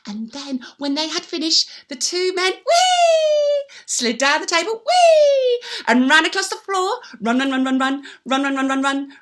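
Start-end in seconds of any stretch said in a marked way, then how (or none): 2.5-3.6 high, loud swooping whee; gleeful whee. Acted. Little men sliding down the chimney, a loud high whee. Elves slide down table. 4.7-5.6 high, loud swooping whee; gleeful whee. Acted. Little men sliding down the chimney, a loud high whee. Elves slide down table.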